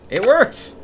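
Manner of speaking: a high, happy "It worked!"; excited. Real, not acted. Streamer sees the puzzle solved, excited cry. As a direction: burst out in relief.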